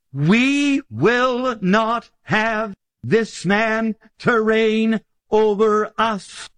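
slow, drawn-out defiant crowd chant; rejecting crowd. Acted. Preacher voicing the defiant crowd, slow chant. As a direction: stretch it out like a mob refusing a king.

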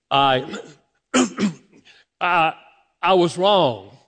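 halting, throat-clearing struggle to confess; reluctant admission. Retold or imitated. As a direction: act out choking on an apology. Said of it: "I did not say it was easy".

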